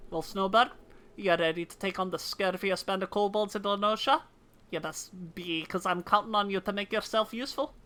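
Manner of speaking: resolute officer in put-on Scottish; fake Scottish accent. Officer readying Snowbird to fight kobolds, fake Scottish accent. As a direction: play a brisk Scottish officer rallying a recruit. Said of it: "in my accent".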